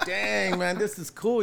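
high, excited "dang, man, this is cool"; amazed. Retold or imitated. Young extra on the set, high excited awe. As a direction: starstruck on a film set.

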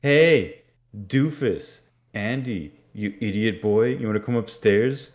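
loud, high teasing shout; big sister jeering down the basement stairs; mocking yell. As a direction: yell down the stairs to mock him. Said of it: "choosing this moment to yell".

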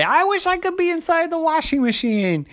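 put-on high-pitched little-girl wish; wistful little girl. A narrator's reading. Child character voiced in story.

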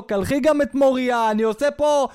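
mock yell across the house; husband shouting to wife. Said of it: "צועק לאשתו". (בעל צועק לאשתו באמצע לייב)

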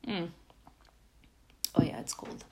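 talking right after a sip of coffee; mid-sip, mouth still wet with coffee; while sipping a drink. Real, not acted. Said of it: "Let me take a sip of my coffee".

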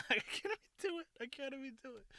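high, squeaky real laughter through the words; cracking up. Real, not acted. Storyteller failing to do the moan, cracking up. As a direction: talk through helpless giggles.

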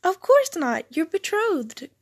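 high, loud clarification; emphatic explaining. A narrator's reading. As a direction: explain as if it's obvious. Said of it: "Dane s-, explained".

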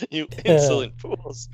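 villain line through laughter; evil queen. Pretend.

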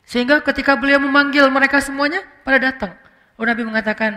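ordinary delivery

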